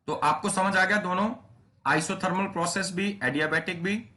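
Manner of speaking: rally the class; energetic check. Real, not acted. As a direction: enthusiastic teacher checking the class understands. Teacher checking understanding.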